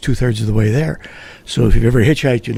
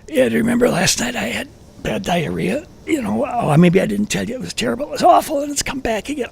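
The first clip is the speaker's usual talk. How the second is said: high, strained whine of fake stomach pain; faking sick; 11 semitones higher, 3 dB quieter than usual. Pretending to the friend he has diarrhea, high strained whine. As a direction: ham up a desperate upset stomach, pitched way up.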